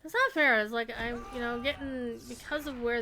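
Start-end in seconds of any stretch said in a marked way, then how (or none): 0.0-0.6 loud whine. Real, not acted. Complaining at game enemies.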